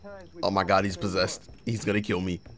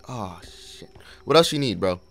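normal talking voice

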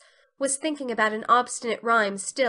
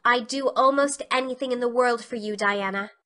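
ordinary delivery, nothing marked

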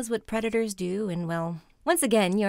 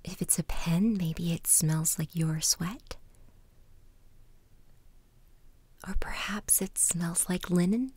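soft spa whisper; 5 dB quieter than usual. Acted. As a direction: whisper calmly, leading each breath. Guided mindfulness exercise in whisper.

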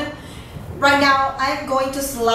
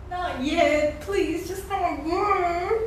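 pleading; 5 semitones higher, 7 dB quieter than usual. Real, not acted. Begging a dying character to live.

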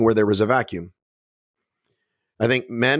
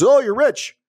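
much higher, louder mock-awed gasp; mock gushing fans; 11 semitones higher, 3 dB louder than usual. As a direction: fawn over the fake rich guy sarcastically. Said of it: "and everybody's".

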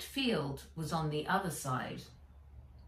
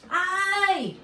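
pained cry; 12 semitones higher, 6 dB louder than usual. A narrator's reading. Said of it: "he cried".

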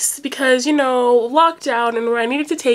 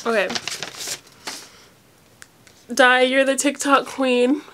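normal talking voice

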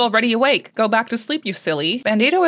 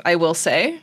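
ordinary talk

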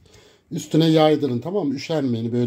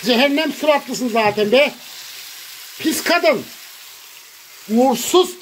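high furious yelling, much higher pitch; angry shouting; 9 semitones higher than usual. Real, not acted. Yelling curses at the woman who sent the dishes, furious. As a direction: scream curses at her, hoarse with rage. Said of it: "beni bağırttırıyor burada ya".

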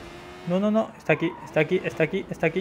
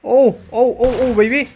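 excited yells; 6 semitones higher, 5 dB louder than usual. Real, not acted. Streamer hyped in action.

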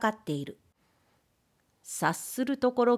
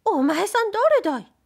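high, loud sharp challenge; wife spitefully challenging the ghost; 13 semitones higher, 6 dB louder than usual. A narrator's reading. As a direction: snap it at the stranger. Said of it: "意地悪にもいきなり".

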